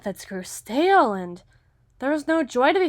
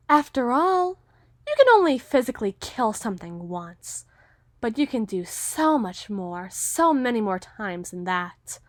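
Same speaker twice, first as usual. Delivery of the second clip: ordinary talk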